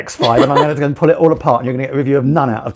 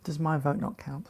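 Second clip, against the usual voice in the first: mock plaintive; 14 dB quieter than usual. The host teasing his wife over the poll, mock-hurt and soft. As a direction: play the wounded party for a laugh.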